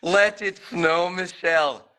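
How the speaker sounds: slow, loud mock Scottish; Scottish accent. Shown as a demonstration. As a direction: give it a go in Scottish. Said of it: "in a Scottish accent".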